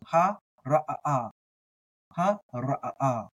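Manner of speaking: Ha, ra'a with a break before ra'a; stuttered stop. Shown as a demonstration. Teacher demos the ayin stutter in the word. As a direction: catch the breath between Ha and ra'a. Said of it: "a little bit of a stutter in there".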